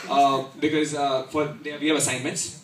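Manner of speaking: normal talking voice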